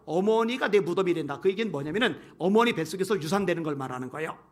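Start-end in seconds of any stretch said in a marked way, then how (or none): none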